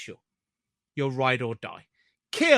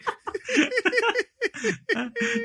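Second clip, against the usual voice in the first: high laugh; 3 dB quieter than usual. Real, not acted. Laughing at the spelling.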